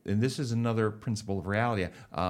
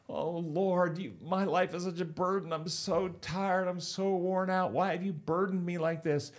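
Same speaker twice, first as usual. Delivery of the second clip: high-pitched, drawn-out mock lament; weary complaint; 9 semitones higher than usual. Man hauling boulders cries to the Lord, high plaintive complaint. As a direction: act out an overburdened man whining up to God. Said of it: "he's pulling it, and he's going".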